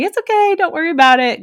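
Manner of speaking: high, sing-out imitation of an intern; high-pitched mock reassurance; reassuring intern. Intern reassures gagging storyteller.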